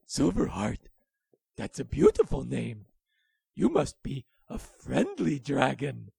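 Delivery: loud, wide-eyed wonder; amazed wolf voice. A narrator's reading. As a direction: marvel at the name. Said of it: "Willy speaks".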